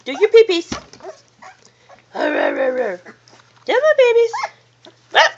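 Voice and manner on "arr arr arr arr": low soft imitated growls; mock growl noises. Owner playfully growling back at her pets.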